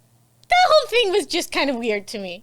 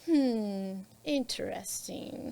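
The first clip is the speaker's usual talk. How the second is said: knowing hmm; 2 semitones lower, 12 dB quieter, much slower than usual. Retold or imitated. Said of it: "she's like".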